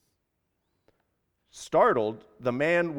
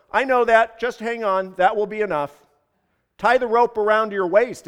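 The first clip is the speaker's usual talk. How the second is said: loud, higher urgent instructions; insistent raised voice; calling up; 5 semitones higher, 7 dB louder than usual. A narrator's reading. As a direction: shout it up the rope. Hanging man calls up instructions.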